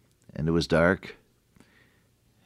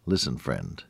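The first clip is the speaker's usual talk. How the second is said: normal talking voice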